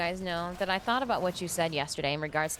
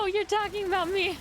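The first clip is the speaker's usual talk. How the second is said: high, giggling through the words; laughing; 11 semitones higher, 4 dB louder than usual. Real, not acted. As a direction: laugh it out, caught off guard. Laughing at chat message.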